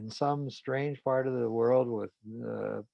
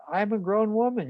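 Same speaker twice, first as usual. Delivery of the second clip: higher, louder defiant protest; indignant retort; 11 semitones higher, 6 dB louder than usual. Retold or imitated. Wife refusing her husband's orders, indignant and higher. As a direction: snap back, not taking orders.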